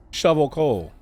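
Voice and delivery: a stern foreman ordering the worker; foreman's order. Acted. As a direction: bark it like a demanding foreman. Game foreman barking orders.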